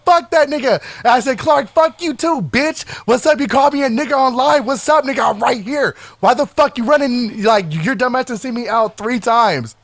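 high-pitched retold fight talk; heated challenge. Calling out a rival on the patio, heated challenge.

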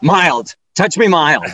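loud mock-panicked yell. Client to masseuse pressing too hard, loud retold yell. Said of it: "I immediately yelled out".

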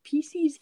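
"Pieces" as PEE-seez, long ee ending; mispronunciation shown. Shown as a demonstration. Rebutting classmates, mock pronunciation PEE-seez. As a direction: stretch the end of "Pieces" into -eez. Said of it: "isn't a word".

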